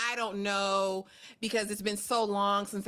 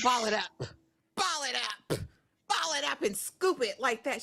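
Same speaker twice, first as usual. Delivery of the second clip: normal talking voice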